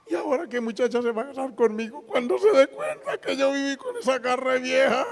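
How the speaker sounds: high-pitched tearful lament; hammed-up weeping, stretched at the end; mock crying. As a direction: wail it like an inconsolable young man. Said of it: "Me llamó llorando".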